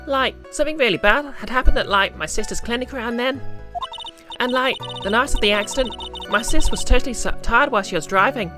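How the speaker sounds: put-on high bubbly Valley Girl voice; Valley Girl accent, lots of like. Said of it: "Valley Girl accent".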